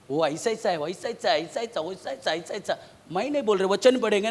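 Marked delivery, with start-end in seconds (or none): 0.1-2.8 low, hushed gossipy chatter; imitated gossip. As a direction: mimic backbiters murmuring on and on. Imitating people's backbiting gossip talk. (चुगली की धीमी, दबी नकल)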